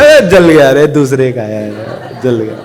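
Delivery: loud start sinking low and easy; carefree relief. Acted. Father hears money is coming, relieved. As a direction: cry out, then drop it carelessly.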